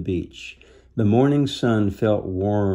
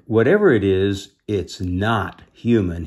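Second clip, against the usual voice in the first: plain reading voice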